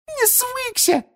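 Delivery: indignant, very high elf squeak; tiny, furious house-elf voice; angry Winky. A narrator's reading. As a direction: squeak it back in outrage. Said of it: "гневно пискнула Винки".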